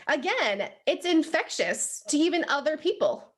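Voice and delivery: ordinary talk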